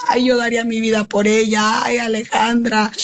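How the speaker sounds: a mother's mild echo of the officer's desperate wail; half-voiced imitation of a frantic plea; retold desperate cry. Retelling the officer's desperate cry to the mother, mildly imitated. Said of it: "a gritar desesperada".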